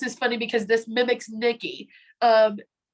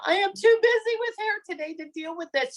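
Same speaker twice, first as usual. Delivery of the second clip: frazzled and at wits' end, pitched way up; retold meltdown; 10 semitones higher than usual. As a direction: act out a mock meltdown at a much higher pitch. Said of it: "I was having a meltdown".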